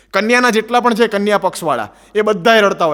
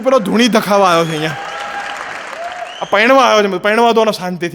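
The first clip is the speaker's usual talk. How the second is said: mock exasperated shout. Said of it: "મેં કીધું".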